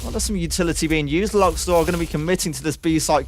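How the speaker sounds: fast, lively esports commentary; excited caster calling a round; play-by-play casting. Acted. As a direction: call the action as it happens.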